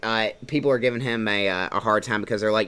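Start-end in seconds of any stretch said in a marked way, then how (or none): none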